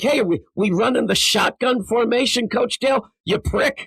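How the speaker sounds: a player taunting Coach Dale the next day at practice; mock yell at coach. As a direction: hurl a cheeky insult up high. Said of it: "That's what I would say".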